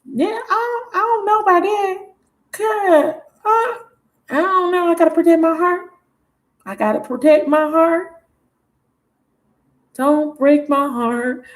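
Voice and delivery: high-pitched, hammed-up hurt lover; mock heartbroken high voice; wary woman's voice. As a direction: play her hesitant and guarded, way up high. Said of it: "And then you like".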